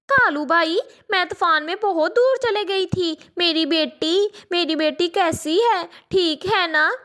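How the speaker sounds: put-on a mother begging for news of her child; anxious mother sparrow. Mother sparrow asks about daughter.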